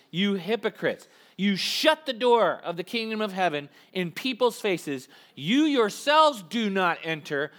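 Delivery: loud, emphatic scripture reading; reading scripture aloud. Shown as a demonstration. Congregation reading Jesus' rebuke together, loud and emphatic. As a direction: declaim it like a prophet's rebuke. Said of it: "Let's read together".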